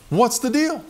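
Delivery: high-pitched, indignant acted protest; comically aggrieved, half-laughing protest; upset complaint. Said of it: "I was kind of upset".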